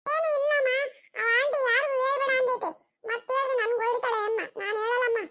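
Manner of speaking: put-on neighbor's small daughter Chinnu answering the mother in a high childish voice; little girl voice. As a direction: play a shy little girl hiding a secret. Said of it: "ಚಿನ್ನು, ಏನ್ ತರ್ಸ್ಕೊಂಡ್".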